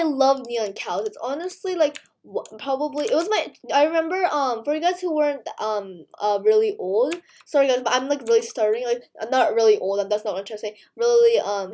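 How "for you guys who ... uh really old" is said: normal talking voice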